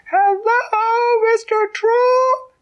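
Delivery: very high, loud, drawn-out call; shouting as loud as she can. A narrator's reading. Ant shouting into the troll's ear, high slow yell. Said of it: "she shouted as loud as she could".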